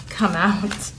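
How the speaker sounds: usual speaking voice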